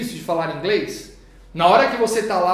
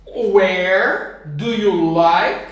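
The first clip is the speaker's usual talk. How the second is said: slow modeling; 5 dB louder, much slower than usual. Shown as a demonstration. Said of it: "teacher models, then corrects herself".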